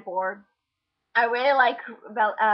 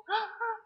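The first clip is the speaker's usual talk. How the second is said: friend's mock gasp; 11 semitones higher, 7 dB quieter than usual. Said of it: "she's like".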